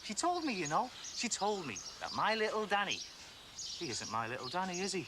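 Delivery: pitched high and shaking with anger; furious revelation. Acted. Husband telling his friend what his wife confessed, furious and high. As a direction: throw it at him, then let it sink.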